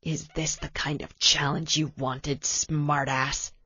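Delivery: low, soft, sneering threat; low mocking taunt from behind; menacing villain whisper. A narrator's reading. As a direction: hiss it at his back like a villain. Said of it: "the man whispered at his back".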